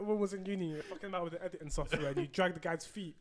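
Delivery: usual speaking voice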